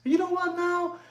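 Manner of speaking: hushed mock-seductive impression; sexy voice. Mimicking the guy with the sexy voice, softly seductive. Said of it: "He has a sexy voice".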